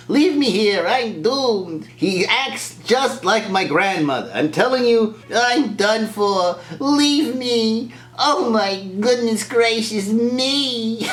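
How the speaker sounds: high, drawn-out theatrical despair; hammed-up high-pitched doomed lament; C-3PO, drama queen. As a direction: wail it melodramatically, stretching the words. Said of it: "What a drama queen".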